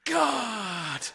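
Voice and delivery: sudden high yelp as his head hits the roof; startled cry. Retold or imitated. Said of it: "and he just went".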